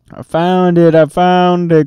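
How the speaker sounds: high, loud thrilled shout; excited cry. A narrator's reading. Jack calling to his sister about the chest, excited. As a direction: burst out with the discovery. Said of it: "cried Jack".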